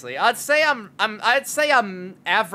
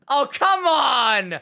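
long, louder, higher come on; drawn-out exasperated groan; 6 semitones higher, 5 dB louder, much slower than usual. Real, not acted. Streamer fed up with a meme, slow exasperated cry. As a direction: stretch it out in exasperation.

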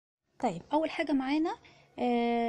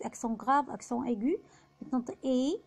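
ordinary talk, nothing marked

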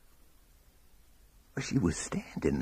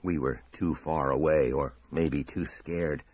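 ordinary delivery, nothing marked

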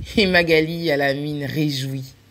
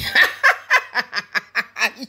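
higher mocking laugh; classmates laughing; 5 semitones higher than usual. Classmates mocking the crying friends, laughing. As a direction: laugh back at the crying girls. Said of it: "ils ont ri".

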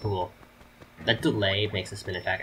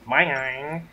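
loud, higher cartoon Boing; Wario voice; 7 semitones higher, 6 dB louder than usual. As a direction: bounce it like Wario. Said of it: "So I'm gonna do Wario voice".

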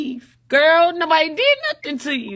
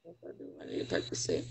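very low, near-whispered insistence; gone quiet; 17 dB quieter, much faster than usual. Real, not acted. Insists to the friend on a Percocet, very quiet. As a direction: barely voice it, low and faint. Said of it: "I'm about to be quiet".

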